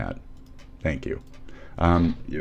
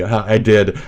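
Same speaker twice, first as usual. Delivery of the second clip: ordinary delivery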